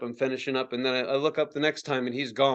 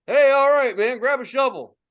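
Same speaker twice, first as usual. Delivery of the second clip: higher, louder imitation of dad; dad calling out; 8 semitones higher, 7 dB louder than usual. Dad to teen arriving in a blizzard, calling out. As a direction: call out brusque orders as dad. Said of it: "My dad's like".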